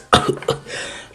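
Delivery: short acted cough. All Might left behind after the blast, coughing. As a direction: cough in character. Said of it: "like, coughing".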